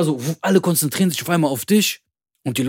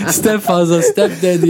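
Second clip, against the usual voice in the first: laughing repeat; 4 semitones higher, 4 dB louder, slower than usual. Real, not acted. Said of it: "Stepdaddy, Stepfather".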